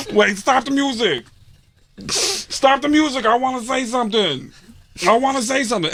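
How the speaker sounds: high, dramatic Tracy plea; emotional Tracy. Retold or imitated. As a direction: stop everything like a drunk star. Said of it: "he goes".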